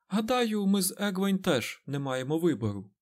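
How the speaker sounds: Nynaeve remarking. A narrator's reading. Nynaeve notes they have no choice either, pointedly. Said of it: "зауважила Найнів".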